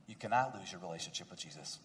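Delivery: hushed, intimate pastoral plea; soft, earnest pastoral appeal. Acted. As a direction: lean in close and soften the voice.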